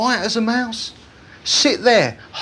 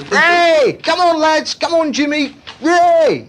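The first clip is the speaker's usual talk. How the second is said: excited football fan; 7 semitones higher than usual. Retold or imitated. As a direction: cheer the lads on like a fan in the stands.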